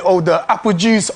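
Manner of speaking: usual speaking voice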